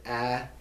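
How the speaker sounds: "a" as the vowel in bat; short A. Shown as a demonstration. Teacher teaches the rule, short "a" as in bat. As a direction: say "a" like cat's vowel. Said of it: "just think bat".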